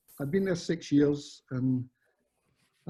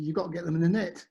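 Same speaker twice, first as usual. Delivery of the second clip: lightly imitated Cockney, pitched up; Frank Lampard; 4 semitones higher than usual. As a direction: deliver the punchline in rough Cockney. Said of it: "I'm not good at Cockney accent".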